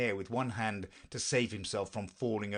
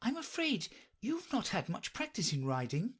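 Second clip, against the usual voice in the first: high, tentative, polite voice; Alice; 12 semitones higher than usual. Acted. Alice ventures a remark on poor riding, high and tentative. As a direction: venture it carefully, pitch raised. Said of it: "she ventured to say".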